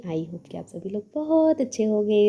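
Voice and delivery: high, drawn-out sing-song welcome; vlog greeting. Acted. Welcoming the YouTube family back to the channel, sing-song vlog greeting. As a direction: greet the channel's followers in a slow, lilting call.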